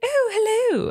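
very high, put-on British accent; high-pitched mock British greeting; British girl voice. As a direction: flute it up high like a dainty British lady. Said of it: "talking with a British accent".

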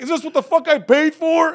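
very high, loud outraged holler; mock heckler yell. Another heckler demanding his money's worth, loud mock holler. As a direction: bellow it like a cheated customer.

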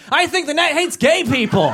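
high, laughing bigot voice; mock zealot. Bigot believer quoted.

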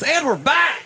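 high, punchy radio host shout; radio announcer voice. Retold or imitated. Imitating a radio host for friends, announcer voice. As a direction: bark it like a morning show jock. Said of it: "the guy that does eight voices".

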